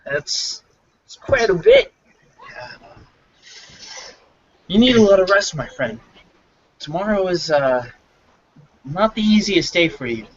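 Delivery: put-on high, amused older mayor with a laugh; lightly voiced mayor, amused; wry mayor. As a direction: tease the drunk kindly. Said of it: "slowly looks over and goes".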